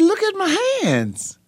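very high imitated tearful plea; retold crying. Son insisting to his dad after being born again, retold tearful plea. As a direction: plead in a high voice, re-enacting the tears. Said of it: "And I'm crying".

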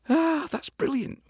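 high, slow, mock-delighted squeal; mock relieved cheer. Mock relieved reply to Ian.

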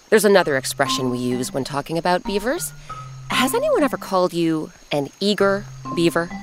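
ordinary delivery

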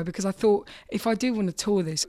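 usual speaking voice

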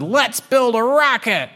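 high, gung-ho kitten cry; rally the team like an eager kid; high-pitched exuberant announcement; excited kittens. Acted.

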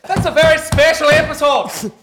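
put-on loud, high show-opening yell in an Irish accent; hammed-up Irish accent. Host greeting the audience in a shouted Irish accent. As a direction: bellow the welcome like a stage Irishman. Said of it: "Is it Irish accent?".

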